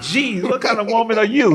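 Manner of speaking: high, loud, laughing disbelief; mock astonishment. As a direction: exclaim it in amused exasperation. Mock astonishment at frugal wife.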